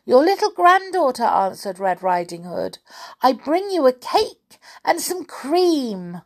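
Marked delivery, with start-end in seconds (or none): 0.0-1.2 put-on high, quick, loud child voice; chirpy storybook girl, pitched high; little girl. Girl answers at the door. 3.2-6.2 put-on high-pitched storybook child; eager granddaughter, high; little girl. Girl speaks through door.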